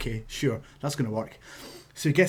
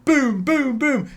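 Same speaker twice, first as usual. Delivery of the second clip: booming impacts, pitched higher and louder; high mock chop booms; 10 semitones higher, 5 dB louder, much slower than usual. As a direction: punch out the chop sounds, higher. Imitating the chops.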